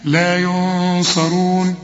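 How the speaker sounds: slow لا ينصرون with the nun hummed; correct recitation. Shown as a demonstration. Teacher gives the right reading again, slow.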